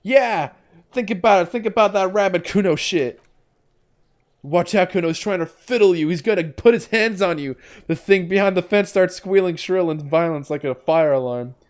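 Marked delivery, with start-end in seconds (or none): 0.0-7.6 put-on high, brash kid voice; bratty kid voice, pitched up and cocky; Kuno the feral boy. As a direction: play a crude, cocky street kid. Kuno voice again.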